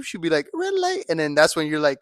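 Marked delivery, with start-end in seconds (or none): 0.5-1.0 squeaky mock girl saying it; high girl voice. Friend voicing the girl in his fire truck joke. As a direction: pitch up into a playful girl's voice.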